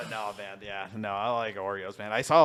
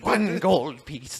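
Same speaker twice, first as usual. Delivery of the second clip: leprechaun voice; 5 semitones higher, 5 dB louder, much slower than usual. Pretend. Leprechaun joke continues.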